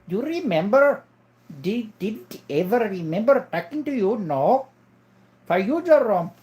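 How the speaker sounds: put-on high-pitched Indian-accented questioning. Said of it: "an Indian guy".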